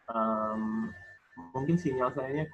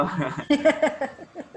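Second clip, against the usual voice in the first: burst of laughter; 9 dB louder than usual. Real, not acted. Student's answer amuses the teacher.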